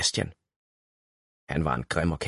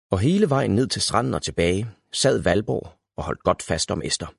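usual speaking voice

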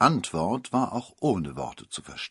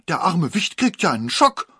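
high, loud worried cry; upset mother; 7 semitones higher, 7 dB louder than usual. A narrator's reading. The mother cries out for her baby, high and loud. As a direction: cry out for the poor child. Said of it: "rief die Mutter".

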